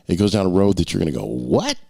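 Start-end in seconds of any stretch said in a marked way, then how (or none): 1.2-1.8 a sudden high "What?" of disbelief; stunned reader. Pretend. Author imitates a reader hitting the twist, jolted and high. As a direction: yelp it like a reader caught off guard.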